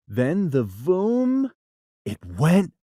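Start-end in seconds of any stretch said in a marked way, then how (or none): none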